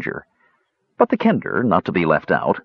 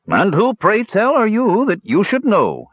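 inquisitive challenge; 11 semitones higher than usual. A narrator's reading. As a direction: draw out the question with pointed curiosity. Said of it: "his thick eyebrows rising inquisitively".

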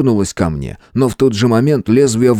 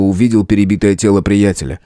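usual speaking voice

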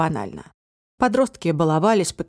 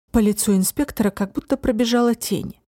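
ordinary delivery

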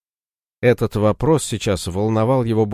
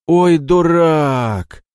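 slow, drawn-out higher jeer; mocking scoff; 8 semitones higher, 6 dB louder, much slower than usual. Ратибор насмехается над Морозом, протяжно. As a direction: stretch it to rub in the insult.

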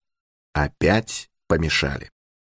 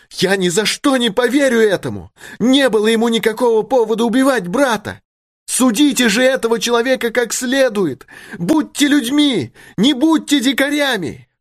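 very high, urgent protest; impassioned plea; 3 dB louder than usual. A narrator's reading. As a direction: plead for the man's life at the top of the range. Daughter pleads for condemned man. (страстная мольба очень высоким голосом)

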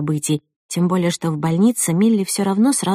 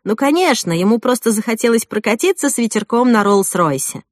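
a light teasing jab, pitched higher; good-natured joke; 4 semitones higher, 4 dB louder than usual. Acted. Guests joking about Jack's Rolls-Royce, mock teasing. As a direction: guests teasing the groom, playfully mocking. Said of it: "добродушные шутки".

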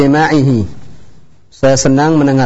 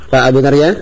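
ordinary delivery

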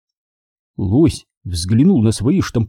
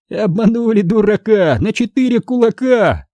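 high singsong jeer; teasing taunt; 10 semitones higher than usual. Acted. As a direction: chant it high to mock the victim. Taunting rhyme after a prank. (дразнилка высоким голосом)

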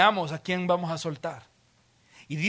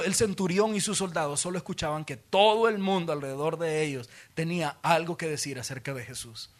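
ordinary delivery

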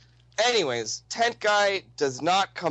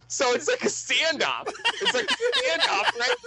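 laughing. Real, not acted. Cracking up telling the standoff.

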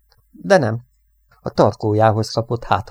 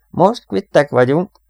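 high, loud, drawn-out retort; shouting back; 8 semitones higher, 7 dB louder, slower than usual. A narrator's reading. Crewmate answers the airman he paid back, shouting. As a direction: call it back forcefully. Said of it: "kiáltott vissza Jack".

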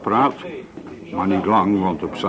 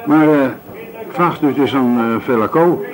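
ordinary talk

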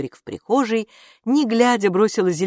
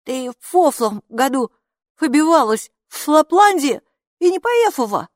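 high, loud muffled mumbling; mouth full; 9 semitones higher, 6 dB louder than usual. A narrator's reading. Хилла speaks with meatballs in her mouth, high garbled voice. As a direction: talk through a mouthful of meatballs. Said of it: "Хилла уже набила рот тефтельками".

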